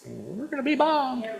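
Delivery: high, soft, drawn-out mock alarm; small high voice playing scared of the bombs; stretched-out squeak of pretend fear; mock panic. Acting out bomb drill alarm.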